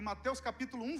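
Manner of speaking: ordinary delivery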